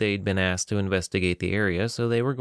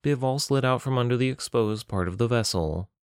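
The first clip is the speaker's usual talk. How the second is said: ordinary delivery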